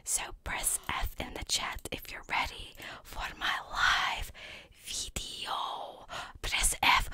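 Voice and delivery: hushed streamer whisper to chat. Real, not acted. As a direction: whisper it all, with no voice. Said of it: "Why was I whispering?".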